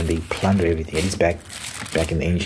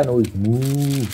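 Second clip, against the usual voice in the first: slow, high, silly mooing; mock cow moo; 5 semitones higher, much slower than usual. Joking about lowing cattle, a silly slow moo.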